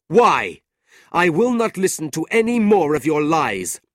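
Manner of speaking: loud, high retort; angry defiance. A narrator's reading. Nicholas angrily refuses to hear his uncle. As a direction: throw it back at him.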